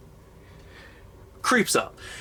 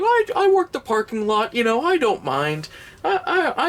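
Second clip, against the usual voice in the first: higher, fumbling mock worker; nervous high-pitched worker voice; 10 semitones higher than usual. Comedian plays the parking lot worker in a stammering higher voice. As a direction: stammer like a flustered parking guy.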